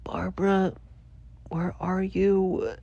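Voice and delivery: high, drawn-out tearful whine; mock crying. Crying out for the missing cat, mock sobbing. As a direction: wail for the lost cat, hammed up. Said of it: "I start crying".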